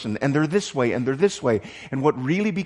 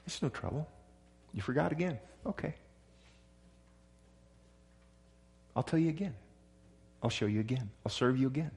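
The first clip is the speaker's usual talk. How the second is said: softer, gentle patient reassurance; patient softness; 10 dB quieter, faster than usual. Retold or imitated. Pastor voices patient grace to a forgetful friend, softly. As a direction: say it softly, no trouble at all.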